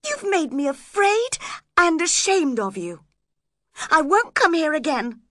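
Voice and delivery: high, loud, wounded outburst; upset reproach. A narrator's reading. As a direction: declare it hurt and indignant. Hurt man rejects her.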